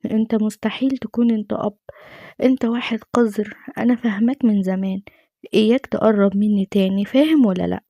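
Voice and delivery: usual speaking voice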